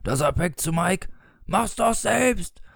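high, drawn-out joke punchline; booming punchline. A narrator's reading. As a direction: blare the punchline, stretching it out. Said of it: "dröhnte Gory". (dröhnend gerufene Pointe)

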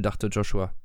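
ordinary delivery, nothing marked

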